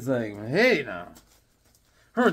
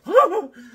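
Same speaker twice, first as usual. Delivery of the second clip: high burst of laughter; 7 dB louder than usual. Real, not acted. Laugh after mom story.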